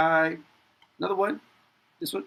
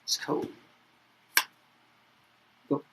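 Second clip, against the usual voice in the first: ordinary talk, nothing marked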